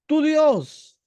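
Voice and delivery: loud, high-pitched accusation; complaining. Retold or imitated. The people complaining to Moses, loud reproach. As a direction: hurl it as a grievance, pushing God away. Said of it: "el pueblo venía y se quejaba con Moisés".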